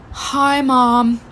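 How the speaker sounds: heavy sighs, then a weak little greeting; hammed-up feeble patient; milk the fake illness with drawn-out breaths; dramatic faking sick. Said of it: "so dramatically, like".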